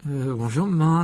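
hesitant, falsely jolly greeting; forced cheerfulness. A narrator's reading. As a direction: try to sound breezy and fail. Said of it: "s'efforçant sans grand succès d'adopter un ton joyeux".

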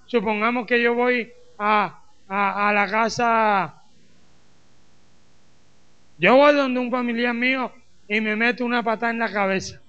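ordinary talk, nothing marked

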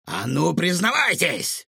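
villain's order, climbing in pitch; angry demand. A narrator's reading. Cook advances on children with pan, angry demand. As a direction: demand it, pitch leaping at the end. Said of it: "Яростно прошипел он".

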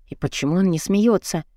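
ordinary delivery

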